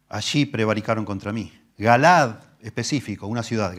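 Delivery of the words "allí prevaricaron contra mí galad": solemn scripture reading. Acted. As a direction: read the psalm with gravity. Reading the prophet aloud.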